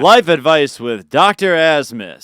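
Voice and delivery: slow, loud show-host announcement; announcer voice. Pretend. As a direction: introduce the segment like a host. Announcing a segment title.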